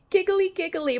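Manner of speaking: high giggles; giggly laughing. Real, not acted. Giggling at the song.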